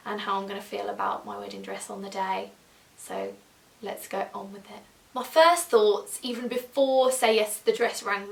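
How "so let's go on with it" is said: ordinary delivery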